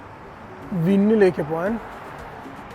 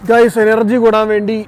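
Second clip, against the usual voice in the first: excited; 3 semitones higher, 8 dB louder than usual. Real, not acted. Showing off energy drink.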